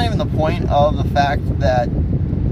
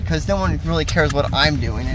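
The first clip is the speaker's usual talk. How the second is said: ordinary talk, nothing marked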